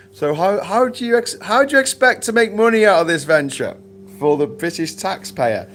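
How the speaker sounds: high, loud pointed question; mock challenge. Panel guest grilling the posh pundit, in a high loud challenging voice. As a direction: fire the question at him, pitched high and loud.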